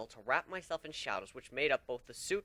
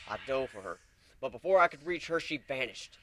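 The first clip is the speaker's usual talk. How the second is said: ordinary delivery, nothing marked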